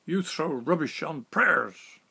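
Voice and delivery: slow, loud, indignant broken English; angry accusation. Retold or imitated. Captain accusing the narrator of desecration, slow loud anger. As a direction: hurl the charge at him.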